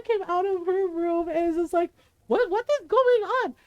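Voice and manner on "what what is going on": high, startled imitation of grandmother; alarmed. Lola coming out of her room to the noise, alarmed. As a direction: burst in demanding an explanation.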